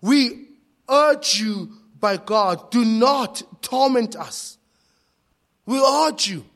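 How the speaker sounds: loud, high-pitched plea; desperate cry. Acted. Demons pleading with Jesus not to torment them, desperate cry. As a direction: cry out and beg, pitched well up. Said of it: "This was a cry".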